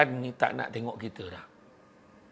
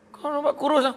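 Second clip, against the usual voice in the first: high teasing voice; 11 semitones higher than usual. Acted. Friend teasing about being thin.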